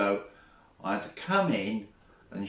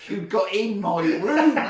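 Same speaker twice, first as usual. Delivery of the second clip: high, loud, through laughter; laughing disbelief; 8 semitones higher, 5 dB louder than usual. Real, not acted. Teacher recalls boy sneaking into the room, laughing incredulously. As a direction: exclaim it while cracking up.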